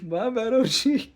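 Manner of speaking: laughing. Real, not acted. Laughing apology to friend.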